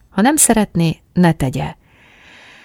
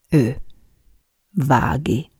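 ordinary delivery, nothing marked

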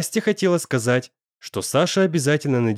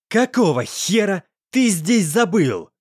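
loud, slow, higher snarl; angry growl; 7 semitones higher, 3 dB louder than usual. A narrator's reading. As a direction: snarl it at the intruder. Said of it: "Злобно зарычал он".

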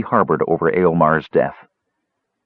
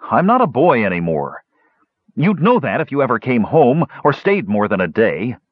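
higher-pitched, resentful nephew; belligerent young dwarf; 5 semitones higher than usual. Acted. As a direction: protest stubbornly to your uncle. Basalt argues back.

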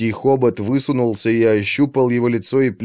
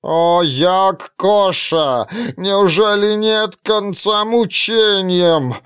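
tormented creature wail; 8 semitones higher, 4 dB louder, much slower than usual. A narrator's reading.

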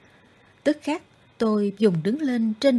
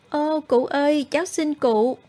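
put-on pleading boy; 5 semitones higher than usual. A narrator's reading. Boy begs old man to go home.

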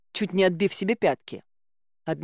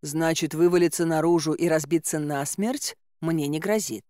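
usual speaking voice

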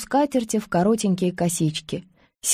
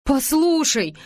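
higher, urgent plea to be heard; urgent interruption; 7 semitones higher, slower than usual. A narrator's reading. Anush tries to interrupt Nazeli, urgent and high. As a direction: try to cut in over her. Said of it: "попыталась перебить её Ануш".

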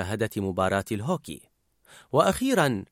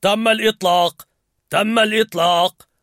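loud, higher call; triumphant announcement; 7 semitones higher, 6 dB louder than usual. A narrator's reading. Dad's Fear announcing the launch, triumphant. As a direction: announce it like a win. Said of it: "أعلن خوف الأب".

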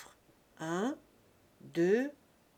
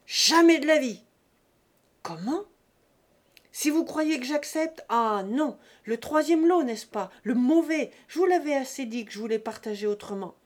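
loud, high furious outburst; angry shouted tirade; explosion of anger; 4 semitones higher, 8 dB louder than usual. A narrator's reading. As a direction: explode in indignant refusal, much louder. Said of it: "Sa voix éclata de colère".

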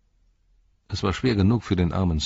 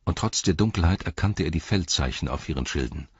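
plain reading voice

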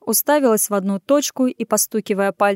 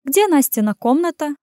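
higher, eager woman's voice; undisguised eagerness; 7 semitones higher than usual. A narrator's reading. Woman asking about another's room, keenly excited. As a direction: ask with open relish. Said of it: "с нескрываемым азартом уточняя".

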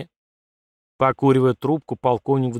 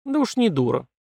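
snorting retort; 6 semitones higher than usual. Acted. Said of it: "фыркнула в ответ Катерина".

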